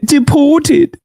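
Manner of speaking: high-pitched British mimicry; mock British accent. Streamer mimicking a British person's word, mock British accent. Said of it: "My man said".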